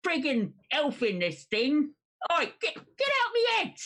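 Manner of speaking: alarmed game character. Acted. Player voices elf-in-head panic.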